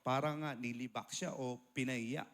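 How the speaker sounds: usual speaking voice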